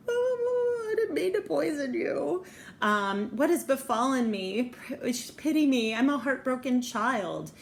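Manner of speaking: high, whimpering theatrical plea; hammed-up tearful pleading; mock heartbroken wail. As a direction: play the pitiful, wronged girl tearfully. Acting out the wounded girl's reply.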